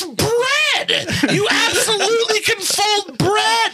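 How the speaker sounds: high, slow outraged yell; outraged shout. Acted. Heated French toast argument.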